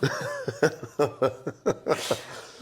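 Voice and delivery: high, loose laughter; real laugh. Real, not acted. Laughing at memory.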